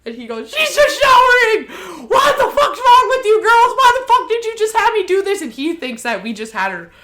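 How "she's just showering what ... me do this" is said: high, loud hammed-up outraged shouting; acted dad yelling. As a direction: yell at the girls, voice shooting way up. He yells at all of us.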